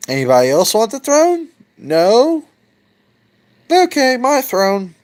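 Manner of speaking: high, fast, cocky challenge to the room; lightly voiced gloating new king; a hint of a smug victor. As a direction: claim the throne with swagger. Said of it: "And it's like".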